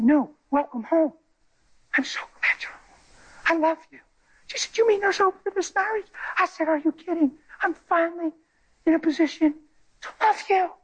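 high, tender, emotional; tender welcome. Retold or imitated. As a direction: welcome her home lovingly. Tender reunion with wife, high emotion.

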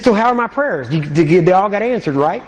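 ribbing him playfully; playful teasing. Retold or imitated. Teasing the cashier about answered prayers, playfully. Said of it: "humorous mood".

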